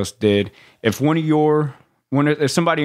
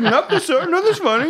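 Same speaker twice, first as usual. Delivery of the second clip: recruit. Retold or imitated. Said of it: "I was like".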